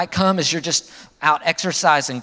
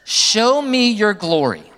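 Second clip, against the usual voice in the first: slow, high and louder plea of Moses; Moses pleading; 6 semitones higher, 4 dB louder, slower than usual. Retold or imitated. Moses begging God to show his glory, slow and loud. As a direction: stretch it out, lifting it up to God. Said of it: "he says".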